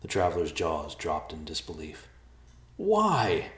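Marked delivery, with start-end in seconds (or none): 2.8-3.5 high-pitched anguished cry of a question; stunned, high outcry. A narrator's reading. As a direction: cry it out high, falling at the end. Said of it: "Thomas cried".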